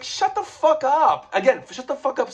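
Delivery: shouting up at the absent writer in frustration; exasperated rant. Real, not acted. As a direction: snap at him in disbelief. Ranting at Stan Lee.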